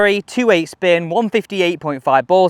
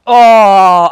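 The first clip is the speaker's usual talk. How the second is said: loud delighted oh; excited oh; 10 dB louder than usual. Real, not acted. Golfer thrilled by his drive, loud excited oh. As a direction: burst out at the shot. Great drive just struck.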